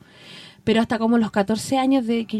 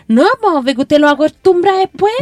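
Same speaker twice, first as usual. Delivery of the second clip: put-on higher, louder young girl scolding; girl's scolding voice; 4 semitones higher, 7 dB louder than usual. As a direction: imitate a bossy girl telling her off. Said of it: "Y me hablaba así la niñita".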